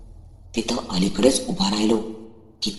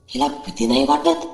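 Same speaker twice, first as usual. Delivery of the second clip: surprised. A narrator's reading. Narrator wonders aloud, surprised.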